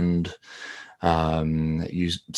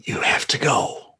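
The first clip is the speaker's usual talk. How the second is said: faint, voiceless plea; whispered warning; 6 semitones higher than usual. Acted. Trapped Chris telling his friend to leave, whispered. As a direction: urge them away in a whisper.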